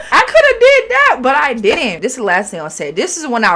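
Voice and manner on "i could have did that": indignant. Real, not acted. Indignant retort.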